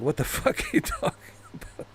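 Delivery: mock bafflement collapsing into laughter; laughing imitation. Retelling friend's confused reply, cracking up. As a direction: lose it while quoting a baffled friend.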